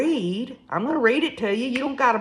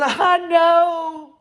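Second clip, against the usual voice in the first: high, louder drawn-out wail; mock weeping; imitated weeping; 8 semitones higher, 4 dB louder than usual. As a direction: wail it like a tearful mother. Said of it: "emotionally weeping".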